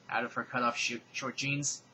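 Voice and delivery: normal talking voice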